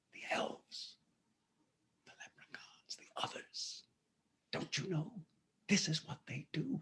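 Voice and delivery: breathy secret whisper; hushed whisper. Acted. Stranger hints at fairy folk, whispering.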